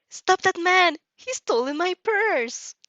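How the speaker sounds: put-on high, loud panicked cry of an old woman; screaming for help. Robbed elderly woman screaming after the purse thief, panicked.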